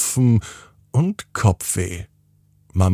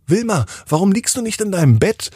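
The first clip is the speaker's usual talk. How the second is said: mother's voice; 12 semitones higher, 3 dB louder, faster than usual. Acted. Mama voiced in story.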